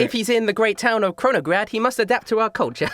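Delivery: high-pitched prim insistence; high showgirl voice laying down rules; stage magician showman. Acted. Magician answers in character.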